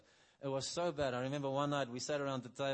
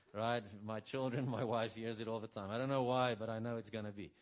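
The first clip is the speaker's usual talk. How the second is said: usual speaking voice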